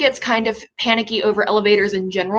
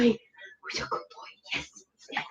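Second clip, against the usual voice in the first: breathy whispered dog praise; 13 semitones higher, 13 dB quieter than usual. Real, not acted.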